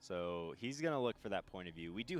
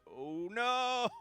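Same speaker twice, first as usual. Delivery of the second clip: high, loud wail of dismay; long, raised "oh no" in real dismay; dismayed outcry; 7 dB louder than usual. Real, not acted. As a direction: cry out as the attempt falls apart. Reacting live to a failed attempt.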